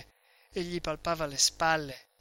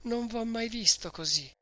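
through sobs; 7 semitones higher than usual. A narrator's reading. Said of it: "a stento tra i singhiozzi".

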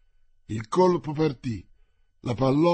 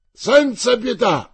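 much higher, loud, slow cry; fierce yell; 9 semitones higher, 9 dB louder, slower than usual. A narrator's reading. The cavalier charges, yelling no mercy. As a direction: scream it as a charge. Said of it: "urlò in francese".

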